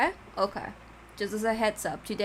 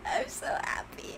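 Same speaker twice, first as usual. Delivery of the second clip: whisper; 3 dB quieter than usual. Real, not acted. Overjoyed streamer whispers to self.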